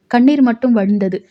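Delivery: plain reading voice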